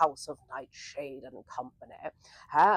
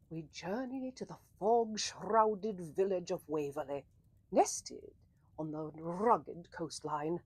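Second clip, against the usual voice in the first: hushed story narration; 3 semitones lower than usual. Acted. Scene setting narration.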